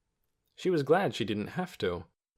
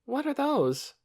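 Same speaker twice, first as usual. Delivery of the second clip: put-on curious young girl; slower than usual. A narrator's reading. Child asks about chickens.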